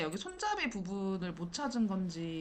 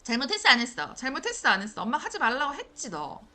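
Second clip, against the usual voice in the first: loud, furious mother yelling; angry mom scolding; 3 semitones higher, 9 dB louder, faster than usual. Retold or imitated. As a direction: scold the kid like a mad mom. (화난 엄마처럼 큰 소리로 꾸짖기)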